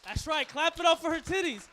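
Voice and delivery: loud, high rallying shout; hyping the crowd. Real, not acted. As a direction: whip the crowd into applause. Comic rallying crowd to applaud.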